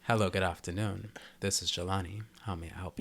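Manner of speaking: phone voice. Pretend. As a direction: answer like a smooth work phone call, low and quiet. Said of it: "Let me go put on my phone voice".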